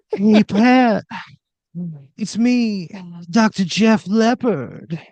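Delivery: high, loud breathy croon; breathy talk-down. Acted. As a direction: purr it like a glam rock star. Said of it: "like a breathy talk down".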